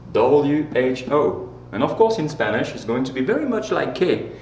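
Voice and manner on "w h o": W H O, each letter slow and clear; careful slow spelling for the class; spelled out slowly. Shown as a demonstration. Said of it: "you're gonna use who".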